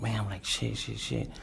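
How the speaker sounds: usual speaking voice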